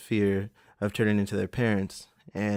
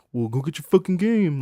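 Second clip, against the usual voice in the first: raised, louder mock snap of an annoyed dad; imitating shitty dad; 8 semitones higher, 6 dB louder than usual. Dad in the game store to his kid, imitated shitty and loud. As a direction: mimic a fed-up father, pitched up high and loud. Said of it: "all shitty".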